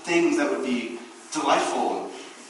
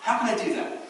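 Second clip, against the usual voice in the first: higher, eager questioning; eager asker; 6 semitones higher than usual. Retold or imitated. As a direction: ask with keen eagerness. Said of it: "We're asking".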